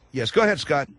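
ordinary talk

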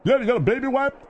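high mocking jab. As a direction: sneer it up high. Mocking the baby talk.

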